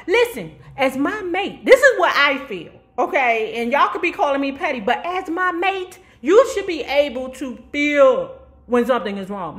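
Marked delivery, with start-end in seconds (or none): none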